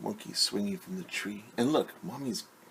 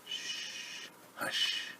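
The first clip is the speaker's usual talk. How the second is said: long soft shush, then hush; whispered hush; 6 dB quieter than usual. Acted. Mother hushing animals near sleeping baby, whispered.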